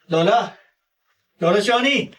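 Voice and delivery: calling out. Acted. Calling grandmother from afar.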